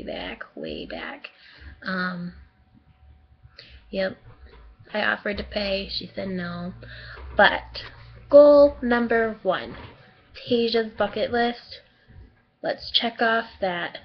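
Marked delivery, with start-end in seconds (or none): 0.0-1.3 whispered, lower way back. Real, not acted. Exaggerated aside about long ago. 8.3-11.8 slow proud announcement; announcing. Real, not acted. Announcing bucket list goal.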